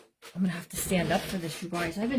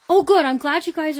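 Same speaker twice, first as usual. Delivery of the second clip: delighted exclaim; 8 semitones higher, 8 dB louder than usual. Real, not acted. Viewers getting the printables.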